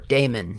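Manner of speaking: long ay in the first syllable of Daemon; DAY-mun pronunciation. Shown as a demonstration. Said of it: "however you pronounce it".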